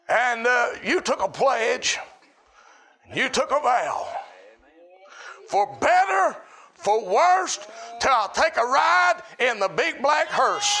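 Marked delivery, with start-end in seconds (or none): 5.5-10.8 rising shout on for better, then drops; preacher hollering the wedding vow. Real, not acted. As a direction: belt out the rhyme.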